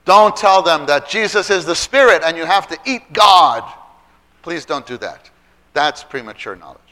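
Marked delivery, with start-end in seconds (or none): 0.0-3.6 loud, raised-pitch warning; emphatic warning. Real, not acted. Preacher telling teachers what not to tell children, loud emphatic warning. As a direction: warn them forcefully, voice up.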